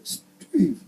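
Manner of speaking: high, loud put-on guitar voice; far-fetched high character voice for a guitar; talking guitar. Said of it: "the guitar was like".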